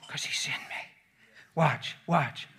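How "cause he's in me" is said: soft low unvoiced whisper; preacher quietly confessing faith. Real, not acted. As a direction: breathe it like a secret. Intimate faith close.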